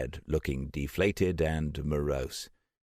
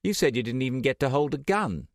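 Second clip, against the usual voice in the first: quick, higher accusing jab; rushed, raised-pitch accusation; 8 semitones higher, faster than usual. A narrator's reading. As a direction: catch him out, fast. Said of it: "Trish said in accusation".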